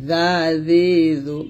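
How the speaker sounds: জা জি জু light, tongue tip at upper teeth; thin th-like buzz through জা জি জু; thin zal. Shown as a demonstration. Teacher drilling tongue-tip position, thin zal. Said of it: "পাতলা করে উচ্চারণ করবো".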